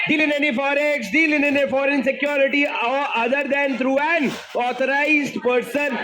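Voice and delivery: slow, sing-song recitation of the law; drawn-out rote recital; choral recitation. Shown as a demonstration. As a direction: chant the clause slowly for the class to echo. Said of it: "अरे जोर से बोलो".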